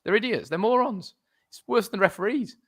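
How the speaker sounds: high, indignant outburst; exasperated rant. Real, not acted. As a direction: vent at the idiots in charge. Ranting about football authorities.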